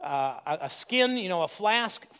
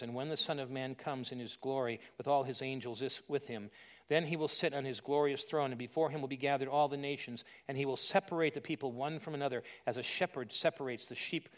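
hushed scripture reading; 8 semitones lower, 10 dB quieter than usual. Acted.

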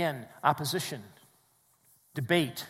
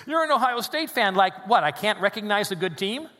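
higher, louder incredulous retort; mock indignation; 5 semitones higher, 6 dB louder than usual. Preacher retelling reactions to his bracket, mock indignant. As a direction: play up the offended disbelief.

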